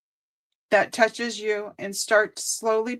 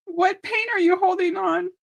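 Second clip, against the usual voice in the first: high, tight voice on the edge of tears; real tearful wobble; choked up; 8 semitones higher than usual. Real, not acted. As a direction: read it while fighting back real tears. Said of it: "I keep wanting to cry".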